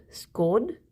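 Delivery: Scorn said with the vowel of Sean; scone rhyming with Sean. Shown as a demonstration. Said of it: "Like the rhyme with Sean".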